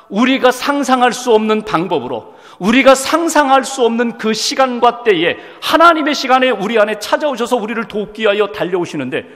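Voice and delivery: ordinary talk, nothing marked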